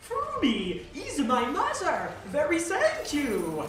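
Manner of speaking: put-on high, loud, slow overblown American accent. Emmy winner shouting thanks in an overblown American accent. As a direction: ham up a fake American accent at an awards show. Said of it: "in the most overblown American accent possible".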